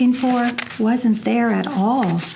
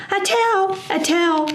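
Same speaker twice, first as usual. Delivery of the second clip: high delighted shout; excited cry; 10 semitones higher than usual. A narrator's reading. Both possums seeing the new tail, high and thrilled. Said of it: "shouted both possums at once".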